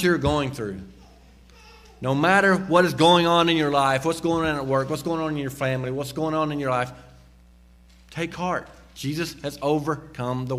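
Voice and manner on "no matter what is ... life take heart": ordinary talk, nothing marked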